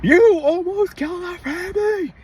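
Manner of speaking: high, loud furious yell; retold road-rage shout; enraged yell. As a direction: scream it at the reckless driver. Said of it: "at the time, I was enraged".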